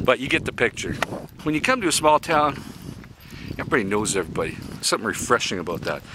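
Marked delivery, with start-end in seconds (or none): none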